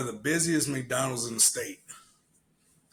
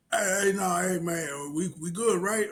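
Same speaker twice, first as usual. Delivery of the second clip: high, whimpering retold plea; mock placating; 6 semitones higher than usual. Challenger making peace with the worker, anxiously placating. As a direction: plead for peace in a raised voice.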